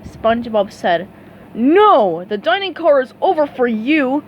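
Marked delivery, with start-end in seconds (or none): 1.5-4.2 loud, slow snapping order; snapped. A narrator's reading. Waiter throwing SpongeBob and Patrick out, snapping loudly. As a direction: bark them out of the car. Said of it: "the man snapped".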